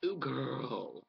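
mock sassy flamboyant exclamation; hammed-up campy voice; flamboyant drag-queen talk. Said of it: "why do we have to be like".

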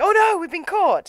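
put-on high, loud kids' cry of being caught; mock panic. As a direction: squeal it like caught schoolboys. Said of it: "they're like".

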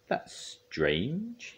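high, puzzled character voice; high-pitched bemusement; puzzled museum man. A narrator's reading. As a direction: say it slowly rising, mystified. Museum man finds bones missing.

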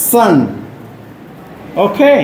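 normal talking voice

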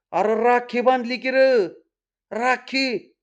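high, aghast outburst; scandalised, high-pitched disbelief; shocked exclamation. A narrator's reading. Stunned at getting a rakhi tied.

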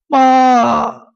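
loud, high cry to mother; tearful shout. A narrator's reading. Son answers his mother's call, shouting in grief. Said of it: "站起来喊道".

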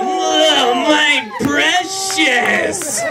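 drawn-out creature croon over "precious"; Gollum. Acted. Comic likening a congressman to a creature, Gollum voice. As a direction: stretch it out like a covetous creature. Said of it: "like, a Gollum".

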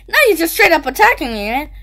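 normal talking voice

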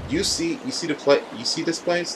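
put-on high girl voice. Voicing game character dialogue.

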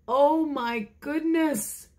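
high, slow amazed exclaim. Acted. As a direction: draw out the amazement. Reacting to swallowed bee.

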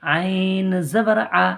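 slow, drawn-out chant of the syllable; recitation drill. Shown as a demonstration. Teacher chants letter drills slowly for the class. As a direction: chant it slowly for students to copy. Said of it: "এটা আকার".

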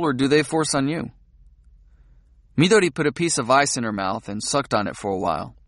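ordinary delivery

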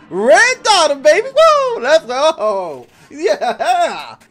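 loud triumphant whoops and laughter; excited cheering. Real, not acted. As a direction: celebrate the win at full volume. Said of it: "victory after hard fight".

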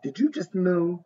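ordinary delivery

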